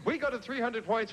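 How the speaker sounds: upbeat score announcing; game show host. Acted. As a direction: call the scores like a quiz host.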